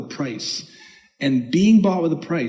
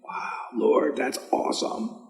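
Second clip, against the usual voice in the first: breathless whisper of wonder; awed whisper; 5 dB quieter than usual. Retold or imitated. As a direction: whisper it in soft amazement. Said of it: "And it was like".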